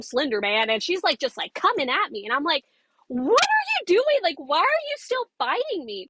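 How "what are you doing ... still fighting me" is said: high-pitched disbelieving shout at her attacker; incredulous cry. Retold or imitated. Woman being scratched asks her attacker why she keeps fighting, high incredulous cry. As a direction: throw it up high, baffled and alarmed.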